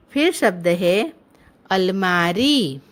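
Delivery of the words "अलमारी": अलमारी slowly, with मा and री held; drawn-out teaching demo of अलमारी; long vowels. Shown as a demonstration. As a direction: stretch the final ee of अलमारी. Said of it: "शब्द का उच्चारण थोड़ा लंबा होता है".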